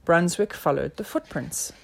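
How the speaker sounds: ordinary delivery, nothing marked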